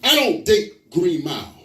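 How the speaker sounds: slow, fed-up brush-off; mildly exasperated rejection. Acted. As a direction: turn a suitor down, spacing the words out. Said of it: "she was just fed up".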